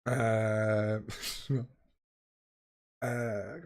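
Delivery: normal talking voice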